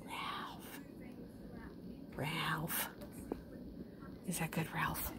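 soft whisper. Real, not acted. Owner softly whispering to the pet Ralph, hushed.

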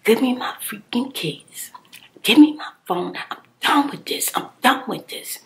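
yelling at the ex for keys and phone; retold yelling. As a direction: demand the keys at full volume. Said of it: "so I started yelling".

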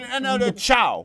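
slow, loud mock goodbye; hammed-up sudden exit; abrupt ciao. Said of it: "He would just be".

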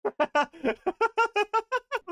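loud, high burst of laughter; high shrieking laugh. Real, not acted. Laughs at found item.

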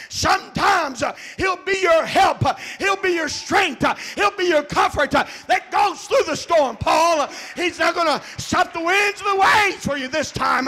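full-force preaching with repeated "uh"; fiery preaching. Acted. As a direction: build the sermon to its peak. Preaching climax.

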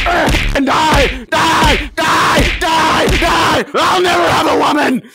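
high, loud rage screams; furious screaming. Acted.

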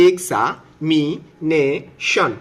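"এক্সামিনেশন" very slowly as এক্সা, মি, নে, শন; syllable by syllable. Shown as a demonstration. Teacher breaking examination at the vowels, syllable by syllable. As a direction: stretch each syllable of "এক্সামিনেশন". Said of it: "আমরা হচ্ছে vowel পর্যন্ত ভাঙবো".

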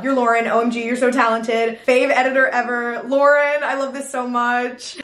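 usual speaking voice

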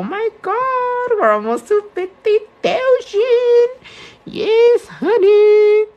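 high, loud squeal of delight; thrilled high-pitched celebration; excited squeal. Real, not acted. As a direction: shriek with real joy at the news. Nearing fifty thousand followers.

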